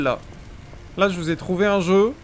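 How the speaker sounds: normal talking voice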